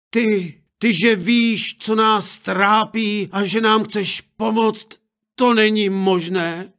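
high, disbelieving exclamation; stunned parents crying out; astonished parents. Acted.

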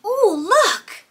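high "ooh" after a gasp; playful wonder. Pretend. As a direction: marvel at a surprise for small children. Reveals a new toy.

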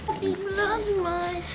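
soft, slow, high model phrase; teaching a parrot. Shown as a demonstration. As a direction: say it softly for the bird to copy. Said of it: "Say happy Vlogmas".

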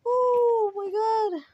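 high, drawn-out oh my God; a long exasperated groan of oh my God. Real, not acted. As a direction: stretch it out in pure exasperation. Exasperated reaction.

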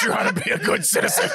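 high voice cracking with laughter; laughing. Acted. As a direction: say it through giggles. Batman line in skit.

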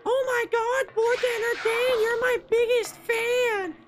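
very high, ditzy falsetto girl impression; stupid chick voice. Streamer re-reads fan's message, stupid high chick voice. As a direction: squeal it like a mock airheaded fangirl. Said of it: "a stupid chick voice".

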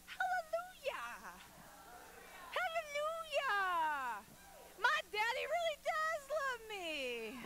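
high, drawn-out hallelujahs full of joy; ringing exultant praise, slow and high; joyful praise. Pretend. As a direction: shout it to heaven like a happy child. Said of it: "So we should say".

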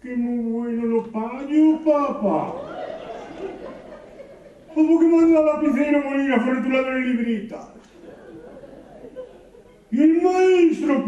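put-on high, slow, whiny kid voice; lightly voiced child. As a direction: play a little kid griping to his dad. Child complaining to father.

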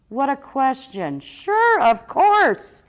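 high, loud, jubilant troll; bursting with happy relief; overjoyed. A narrator's reading. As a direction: build to an elated shout. Troll gladly accepts the invitation.

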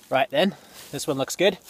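put-on light, offhand British accent; casual mock-British remark while picking trees. Builder picking a tree, in a mild British accent. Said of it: "why I just said that with a British accent".

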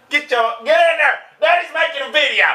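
high-pitched mock shout; acted dad yelling. Dad filming a video shoos his kid out, mock yelling. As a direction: holler it high, shooing a kid away.

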